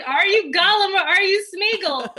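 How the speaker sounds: half-hearted mock yell at the TV; mock frustrated shout. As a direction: play the stoned fan upset at the screen. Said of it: "I was very frustrated".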